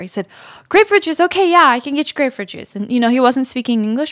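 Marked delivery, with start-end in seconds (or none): 0.7-2.8 higher, fast, eager barista repeating the order; excited barista. Retold or imitated. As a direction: bounce it like a server thrilled to use English. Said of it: "he was really excited to speak English".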